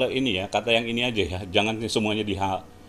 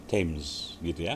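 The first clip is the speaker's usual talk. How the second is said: usual speaking voice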